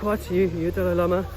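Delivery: high, different voice, astonished; amazed old man. Retold or imitated. Old man seeing the Dalai Lama video, high amazed imitation. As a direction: marvel at the video in his voice.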